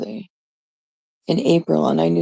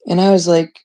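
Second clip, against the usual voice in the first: usual speaking voice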